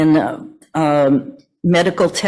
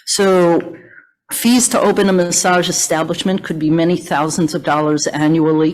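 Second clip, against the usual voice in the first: ordinary talk, nothing marked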